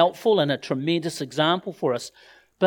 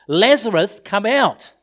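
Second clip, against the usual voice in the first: louder, higher commanding call; 5 semitones higher, 8 dB louder than usual. Acted. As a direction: call out loudly to summon someone. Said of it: "called out in a loud voice".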